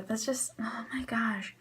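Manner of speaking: ordinary talk, nothing marked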